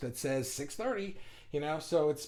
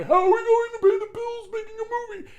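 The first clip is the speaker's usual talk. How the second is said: much higher, louder, squeaked-out worried inner voice; panicky ego voice; 9 dB louder than usual. Acted. Inner ego fretting to the viewers over bills, in a much higher, louder squeak. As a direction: play the ego as a shrill cartoon of panic. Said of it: "my ego is going".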